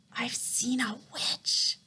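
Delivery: frightened whisper. A narrator's reading. Shaken boy whispers to grandmother about the witch. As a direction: breathe it out in a whisper. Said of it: "I said".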